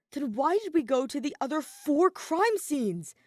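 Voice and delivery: lightly voiced frustrated young detective; a hint of exasperated Shuichi. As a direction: push back, a touch exasperated. Shuichi questioning Monokuma.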